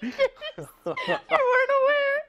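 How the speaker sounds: very high, slow mocking voice; sarcastic aside. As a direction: squeak it out high and slow like a joke. Sarcastic aside after witch joke.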